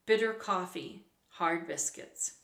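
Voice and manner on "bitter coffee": ordinary delivery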